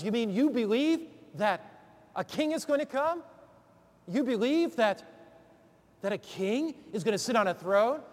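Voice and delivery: incredulous. Acted. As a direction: act out an astonished listener, halting between phrases. Russian voiced as incredulous at king.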